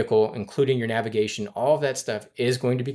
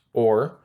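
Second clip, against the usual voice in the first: "or" hit louder, set apart on its own; stress on or; 4 dB louder than usual. Shown as a demonstration. Finance manager coaching staff, punching "or". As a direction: lean hard on "or" so time or miles lands. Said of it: "I do wanna stress the two words".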